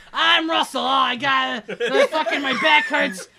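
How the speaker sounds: loud, high, laughing complaint about an aching back; Russell. Retold or imitated. Mock Russell at the DVD rack, loud and laughing. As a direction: groan through it, half laughing at yourself. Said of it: "Do your best Russell impression".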